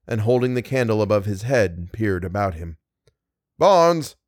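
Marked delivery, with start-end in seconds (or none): 3.6-4.2 high, raised call of a name. A narrator's reading. Meagle searches downstairs for Barnes, calling out. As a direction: call out for a missing friend. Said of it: "he called".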